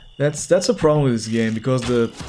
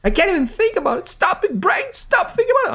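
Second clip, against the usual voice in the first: much higher, fast panicky NPC voice; panicky villager. Acted. Voicing the villager who dreads his sister's fate, high panicky squeal. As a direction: squeal it like a frightened villager scolding his own brain.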